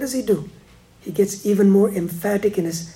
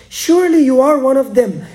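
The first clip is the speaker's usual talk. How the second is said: accusing; 5 semitones higher, 7 dB louder than usual. Retold or imitated. Bystanders accusing Peter.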